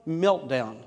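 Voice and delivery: ordinary delivery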